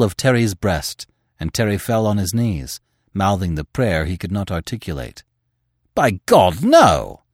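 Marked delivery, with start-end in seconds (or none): 5.9-7.2 loud desperate outburst; cry out. A narrator's reading. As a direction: shout it in protest. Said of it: "cried the editor".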